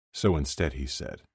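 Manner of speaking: plain reading voice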